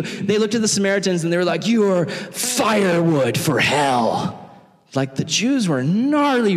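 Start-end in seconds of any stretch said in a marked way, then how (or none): 1.7-4.5 mock condemnation. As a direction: drag out the insult with contempt.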